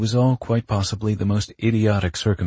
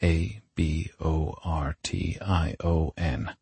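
slow, letter by letter; spelling out; 4 dB quieter, slower than usual. Acted. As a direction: spell it with a gap after each letter. Character mentally spells dreaded word.

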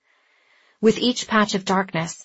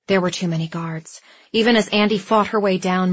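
ordinary delivery, nothing marked

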